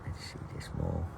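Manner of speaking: soft unvoiced whisper. Real, not acted. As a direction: whisper so it doesn't run. Whispering near animal.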